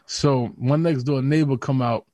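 usual speaking voice

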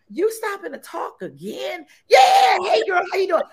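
high, loud mock complaint as the husband; husband complaining. Husband griping about her chatting with people, mock complaint. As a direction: imitate the husband's exasperated whine. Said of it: "my husband's like".

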